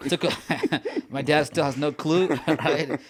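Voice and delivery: chuckling through the words; laughing. Real, not acted. Laughing about his dad.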